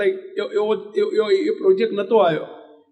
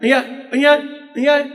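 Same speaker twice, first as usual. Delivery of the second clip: retold loud, higher summoning call; 3 semitones higher, 4 dB louder than usual. Swami summoning the village headman by bare name, calling out. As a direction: call him over curtly, louder and higher. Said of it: "સ્વામીએ બૂમ પાડી".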